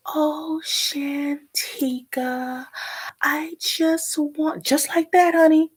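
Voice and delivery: high, syrupy, drawn-out mock sweetness; patronizing mother impression. Mother coming to needle her angry daughter, mocked patronizing slow talk. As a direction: talk down very slowly in a high sing-song. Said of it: "talking like this".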